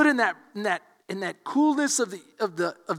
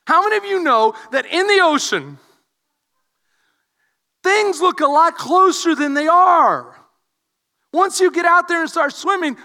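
louder preaching to the congregation; 6 semitones higher, 7 dB louder than usual. Acted. Pastor preaching to his congregation, full voice. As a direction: drive the point home to the room.

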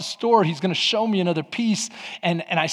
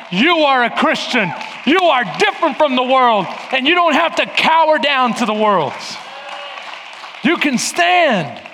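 rousing pulpit cry; preaching at full force; 4 semitones higher than usual. Acted. Preaching climax.

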